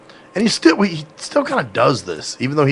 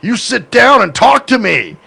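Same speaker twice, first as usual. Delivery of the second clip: loud, higher, drawn-out command; forceful demand; 9 semitones higher, 5 dB louder, slower than usual. Acted. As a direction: grab the listener and demand attention. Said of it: "like them grabbing you".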